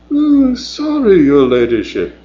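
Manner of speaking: bow and scrape in fun; mock servile. Dell mockingly apologises to Mavis, servile. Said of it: "tugging at an imaginary forelock".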